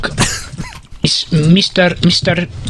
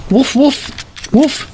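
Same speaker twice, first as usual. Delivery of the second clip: high pretend woofs; dog woofing; 6 semitones higher than usual. Man showing off his pet doggy to viewers, playful dog woofs. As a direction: bark the word woof like a little doggy. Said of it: "my doggy is here".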